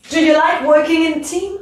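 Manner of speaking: team? climbing at the end; rising question. Shown as a demonstration. Teacher showing a closed question, rising tune. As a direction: swing up on team?. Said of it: "Hop, je monte".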